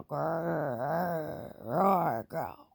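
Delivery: low fading growls; mock tiger growl. A narrator's reading. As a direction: growl low and let it die. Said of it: "sounded like growl".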